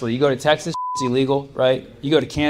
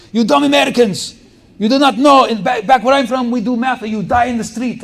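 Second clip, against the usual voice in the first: high, loud ranting foreign professor; mock furious professor lecturing the class; Ukrainian professor; 10 semitones higher, 7 dB louder than usual. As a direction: yell it like an intense Ukrainian teacher. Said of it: "He would always say that".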